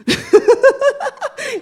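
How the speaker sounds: high, loud burst of laughter; real laugh. Real, not acted. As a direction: laugh at your own joke. Laughs at herself.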